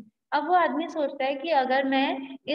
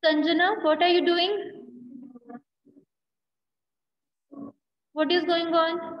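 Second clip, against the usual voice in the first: a raised teacher's voice checking on a student; calling out to a student; 3 semitones higher, slower than usual. Real, not acted. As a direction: break off the lesson to call a student to order.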